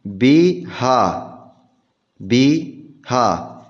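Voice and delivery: بها drawn out very slowly, syllable by syllable; بها said slowly with kasra on the ba; slow spelled-out demo. Shown as a demonstration. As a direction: stretch out بها to show its vowels. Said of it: "أصلها نكتب ماذا؟".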